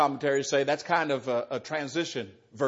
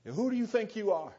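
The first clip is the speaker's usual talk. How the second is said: higher, scoffing mock of a proud crowd; indignant challenge; 7 semitones higher than usual. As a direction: pitch it up like offended elite challenging Jesus. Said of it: "That's what they're saying to Jesus".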